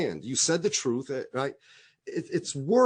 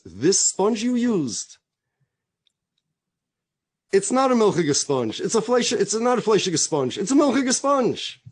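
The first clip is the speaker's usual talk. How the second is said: high, loud, emphatic correction; raised voice, setting him straight; emphatic retort; 6 semitones higher, 6 dB louder than usual. Retold or imitated. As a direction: voice the wife's insistent retort, climbing in pitch. Wife's triumphant retort in story.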